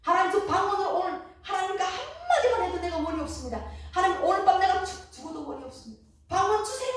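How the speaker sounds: loud, high desperate cry to God; crying out loudly for the gift of tongues; shouting prayer. Retold or imitated. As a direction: yell it with ears covered, begging. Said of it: "어떻게 소리치는지 압니까?".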